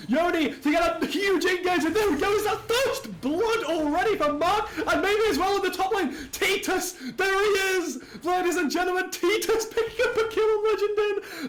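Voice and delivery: very high-pitched, urgent esports casting; excited play-by-play. Real, not acted. Caster calls first blood and a team fight, excited. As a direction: ride the fight at high pitch without letting up.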